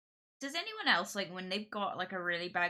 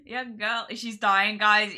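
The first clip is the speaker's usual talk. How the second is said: loud dramatic wail; 3 semitones higher, 12 dB louder, slower than usual. Real, not acted.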